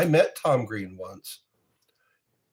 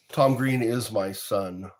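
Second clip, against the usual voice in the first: ordinary talk, nothing marked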